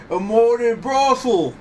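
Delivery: loud, high, drawn-out jeer; mocking jeer. Courtroom mocking her talk of modern thought, loud jeer. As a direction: throw it back high, loud and slow.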